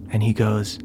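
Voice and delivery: normal talking voice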